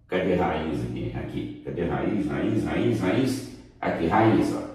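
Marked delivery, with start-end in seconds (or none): none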